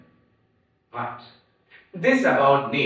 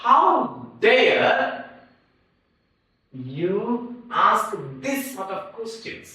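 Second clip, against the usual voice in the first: indignant; 6 semitones higher, slower than usual. Shown as a demonstration. Acted indignant example.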